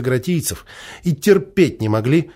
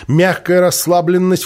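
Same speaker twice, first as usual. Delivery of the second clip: ordinary delivery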